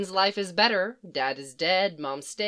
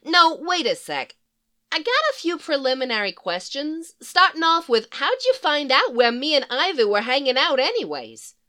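put-on higher, louder, sassy Brooklyn-tinged villain girl; brassy Harley Quinn voice; 7 semitones higher, 4 dB louder than usual. Harley quizzing the psychiatrist who found her, higher and brassy. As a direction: play her cheeky and impatient.

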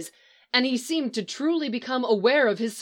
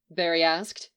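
ordinary delivery

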